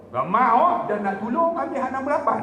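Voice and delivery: high, rising comic protest; mock indignant refusal. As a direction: play up a husband's loud, high objection. Acted indignant refusal to wife.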